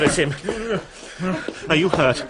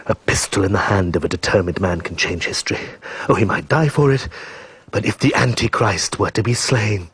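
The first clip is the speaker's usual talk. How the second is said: ordinary talk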